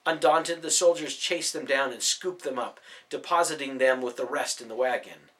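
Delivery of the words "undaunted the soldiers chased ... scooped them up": ordinary delivery, nothing marked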